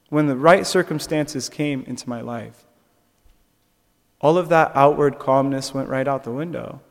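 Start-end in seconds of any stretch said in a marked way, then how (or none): none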